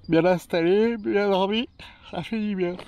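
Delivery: words stretched by a yawn. Real, not acted. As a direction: talk through a big yawn. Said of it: "je baille".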